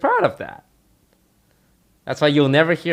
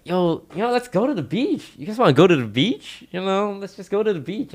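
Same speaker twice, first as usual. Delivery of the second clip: much higher, rising excited pitch; excited party bro; 9 semitones higher than usual. Retold or imitated. Tarek urging everyone to the beach at night, high excited voice. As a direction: sell the beach idea eagerly.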